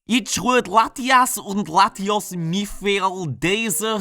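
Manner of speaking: very slow German read, each word sounded out; struggling German reading. Shown as a demonstration. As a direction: stumble slowly through the German text. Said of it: "how the heck are you supposed to pronounce these words".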